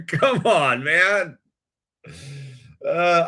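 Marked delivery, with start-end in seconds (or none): 0.1-1.4 higher, louder come on man; loud fed-up come on man; exasperated retort. Retold or imitated. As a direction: throw your hands up at him. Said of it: "It's like".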